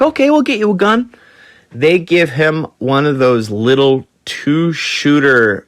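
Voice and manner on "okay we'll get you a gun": high, fast mock reply; a hammed-up breezy high voice; quick squeaky impression of the crew. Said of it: "He's like".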